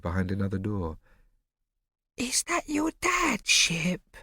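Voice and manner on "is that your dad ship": incredulous whisper. A narrator's reading. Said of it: "Bo whispered incredulously".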